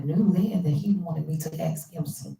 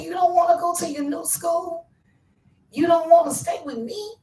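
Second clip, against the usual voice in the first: pleading disbelief; 10 semitones higher, 3 dB louder than usual. Retold or imitated. Mother pleading with crying son.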